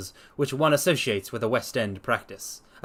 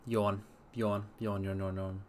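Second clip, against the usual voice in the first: spoken yawns; 3 semitones lower, 9 dB quieter than usual. Pretend. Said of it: "mock yawning aloud".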